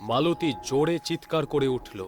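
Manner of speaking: ordinary delivery, nothing marked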